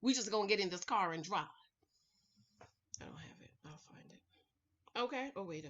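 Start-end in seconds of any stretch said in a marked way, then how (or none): 3.0-4.2 a whispered murmur to herself; quiet whispered aside. Real, not acted. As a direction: say it under your breath while searching. Muttering to herself searching.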